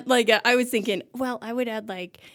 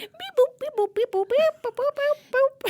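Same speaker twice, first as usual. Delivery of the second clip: much higher beeping robot sounds. Pretend. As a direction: chirp out robot beeps. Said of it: "Can you do that noise again?".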